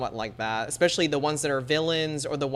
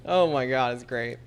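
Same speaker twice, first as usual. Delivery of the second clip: ordinary talk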